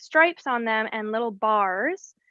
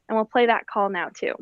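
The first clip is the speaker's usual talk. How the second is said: normal talking voice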